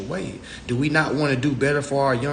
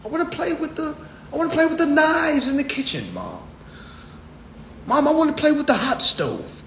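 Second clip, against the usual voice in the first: mock child voice; 13 semitones higher than usual. Little [name] tells mama he wants pots, high child whine. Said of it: "Lil [name] like".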